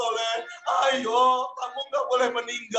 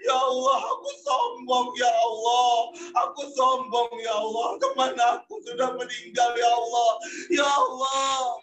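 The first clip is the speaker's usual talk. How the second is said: loud crying prayer. Acted. Jami crying harder as his friend dies, praying. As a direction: cry out your prayer, sobbing harder. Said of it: "Jami nangis makin kenceng".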